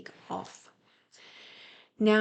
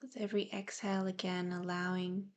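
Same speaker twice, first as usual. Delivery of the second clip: guided meditation; 4 dB quieter than usual. Real, not acted. Host easing listeners into breathing and present awareness, soft meditative delivery. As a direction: gently guide them into calm, leaving space to breathe.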